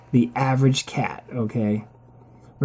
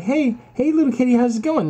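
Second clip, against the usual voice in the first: cooing at a kitten; 8 semitones higher than usual. Retold or imitated. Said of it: "you're like".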